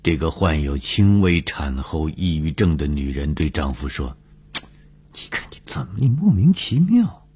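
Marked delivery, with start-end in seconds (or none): 5.1-7.3 starts in a whisper, then swoops up high; complaining wife. Acted. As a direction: scold your husband in a high, wailing lilt. Wife scolds her husband.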